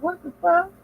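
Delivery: high, shocked what the fuck; shocked high squeal. Retold or imitated. Retelling her shock to viewers, high squeal. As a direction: squeal it out in disbelief. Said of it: "I'm like".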